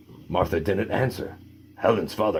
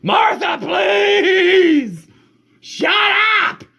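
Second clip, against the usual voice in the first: loud, high, fed-up yell; exasperated shout; 7 dB louder, much slower than usual. Acted. Family yelling at the chatty dog to stop, loud and high. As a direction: shout it like the family has had enough. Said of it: "the family could not stand it and said".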